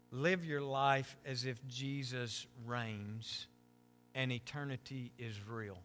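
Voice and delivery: hushed heartfelt exhortation; quiet earnest preaching. Acted. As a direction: lay the key truth down gently and slowly. Soft slow core message.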